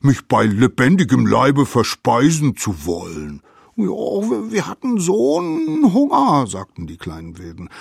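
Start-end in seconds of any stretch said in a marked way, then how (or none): 0.0-3.4 loud, indignant big-beast scolding; grim mammoth. A narrator's reading. Mammoth lifts up the biters, angrily scolding. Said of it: "hob sie grimmig". 3.8-6.4 very high, whiny little voice; pleading little savages. A narrator's reading. Caught savages excuse themselves to the mammoth, pleading.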